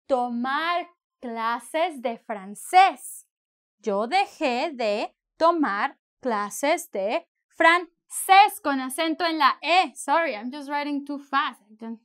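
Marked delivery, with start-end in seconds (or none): 0.1-8.6 slow dictation. Shown as a demonstration. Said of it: "teacher dictating slowly".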